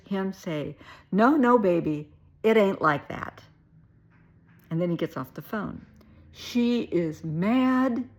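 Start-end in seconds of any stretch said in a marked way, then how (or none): 1.1-3.4 loud imitation of an insistent denial; pleading denial. Overheard phone denial, acted. 6.3-8.1 "She is mad" very slow, stretched and loud; drawn-out emphasis. Real, not acted. Teller stresses the girlfriend's anger.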